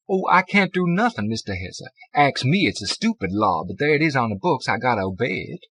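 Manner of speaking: sorry but firm; clerk voice. A narrator's reading.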